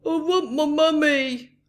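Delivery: put-on a frightened child wailing; scared cry. A narrator's reading. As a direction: wail for your mummy. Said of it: "cried you".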